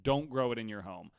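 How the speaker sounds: ordinary delivery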